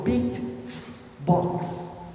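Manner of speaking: beat, tss, box as kick, hiss, snare; beatbox with hi-hat. Shown as a demonstration. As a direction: add a hissed tss between the beats. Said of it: "A simple sound like tss".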